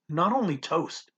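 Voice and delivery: usual speaking voice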